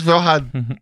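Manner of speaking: Vaughan mimicked as high-pitched VO-han; imitated pronunciation. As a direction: tease with Vaughan in two syllables, sounding the h. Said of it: "This is the way he says".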